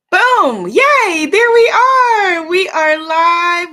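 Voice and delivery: loud, high, slow cheer that the stream is live; excited cheer. Real, not acted. Host cheering to viewers that she is live, loud and high. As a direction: cheer out loud, drawing out each word.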